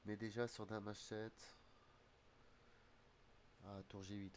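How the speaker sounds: ordinary delivery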